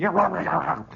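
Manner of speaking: imitated snorting noise; snorting growl. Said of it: "a sort of snorting sound".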